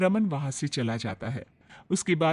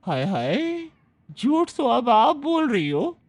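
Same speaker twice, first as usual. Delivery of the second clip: higher vendor voice, slow hurt exclamation; scandalised vendor; 6 semitones higher, slower than usual. Acted. Vegetable seller accusing Priya of lying, scandalised and slow. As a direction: act shocked at being called a liar.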